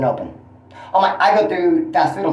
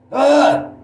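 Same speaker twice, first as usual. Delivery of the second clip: ugh; 10 semitones higher, 4 dB louder than usual. Real, not acted. Frustrated about his roommate.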